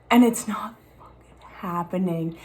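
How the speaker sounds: whispered curse under her breath; real frustrated whisper. Real, not acted. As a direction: hiss it out in a whisper, fed up. Frustrated confession about impatience.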